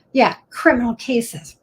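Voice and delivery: usual speaking voice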